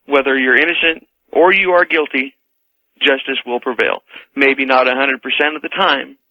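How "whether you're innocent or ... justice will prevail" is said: usual speaking voice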